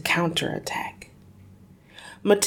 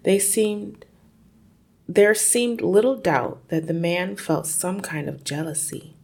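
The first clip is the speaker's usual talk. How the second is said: plain reading voice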